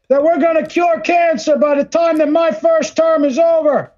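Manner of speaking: high, forceful politician's pledge; mock president. President promising voters a cancer cure, mock oratory.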